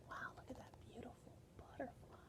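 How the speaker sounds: breathy hushed wonder, quick; whispered aside. Real, not acted. Teacher whispering to children about the butterfly, hushed wonder. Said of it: "teacher aside in whisper".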